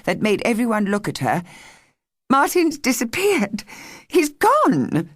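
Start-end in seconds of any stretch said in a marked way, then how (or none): 2.3-5.1 high, strained and near breaking; alarmed girl blurting the news; on the edge of tears. A narrator's reading. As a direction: voice teetering between tears and fury. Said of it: "in a voice on the edge of something, tears or fury".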